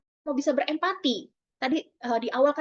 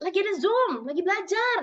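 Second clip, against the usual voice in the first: higher-pitched voice of a complaining mother; disapproving parent; 7 semitones higher than usual. Acted. Busy mother explaining to her crying child, high-pitched mild complaint. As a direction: lightly play a busy mum making excuses. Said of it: "ada lagi disapproving parent".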